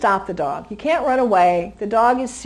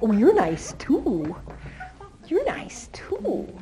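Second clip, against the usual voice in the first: voicing the puppy; 4 semitones higher, 5 dB quieter, much slower than usual. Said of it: "So he goes like".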